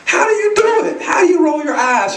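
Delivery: high-pitched pleading question; baffled asking. Retold or imitated. Dad asking his daughter for help, baffled asking. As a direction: ask high, desperate for the trick.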